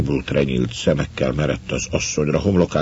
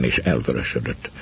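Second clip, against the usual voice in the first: ordinary delivery, nothing marked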